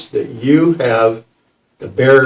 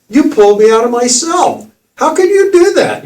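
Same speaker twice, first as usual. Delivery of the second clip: high, rising, louder disbelief; incredulous; 12 semitones higher, 4 dB louder than usual. Retold or imitated. Asking the kid how he did it, high incredulous. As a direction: squeal it in shock.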